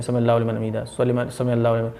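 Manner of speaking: fast, low, soft "समी अल्लाहु लिमन", syllables swallowed; slurred mistaken recitation. Retold or imitated. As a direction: gabble "समी अल्लाहु लिमन हमीदा" until the words blur. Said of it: "ऐसे बोलते हैं". (जल्दी-जल्दी, अस्पष्ट, दबी आवाज़)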